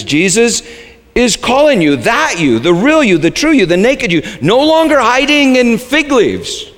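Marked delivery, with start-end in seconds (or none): none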